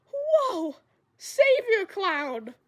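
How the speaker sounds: high, loud and very slow amazed cry; awed cheer. Retold or imitated. Feverish camper hailing the moth-killer, loud and drawn out. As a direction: stretch it out in dazed wonder.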